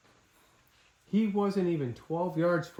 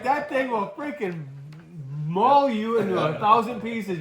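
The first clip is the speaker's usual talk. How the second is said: high-pitched, slow, emphatic warning; urgent warning; 6 semitones higher, 4 dB louder, slower than usual. Retold or imitated. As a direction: drive the warning home, drawn out and raised. Acted warning shouted to son.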